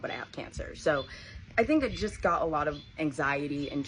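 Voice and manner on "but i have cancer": whispered, soft and unvoiced; a mother's whispered confession; hushed news. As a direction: breathe the bad news gently. Mom breaking bad news.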